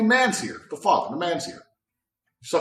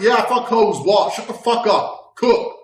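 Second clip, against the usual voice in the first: louder, higher retort; mock shouting back; 6 semitones higher, 5 dB louder, slower than usual.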